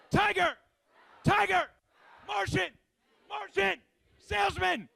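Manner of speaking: high, loud call-outs; shouted calls. Real, not acted. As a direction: bark each word out to pump the crowd. Hype game shouting animal words.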